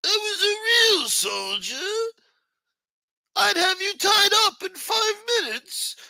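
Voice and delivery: very high, slow, squealing mock of the guy; cartoon high-pitched send-up of a tough talker; mocking his voice. Streamer mocking a caller's threat, squeaky high and slow. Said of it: "Let me do it in his voice".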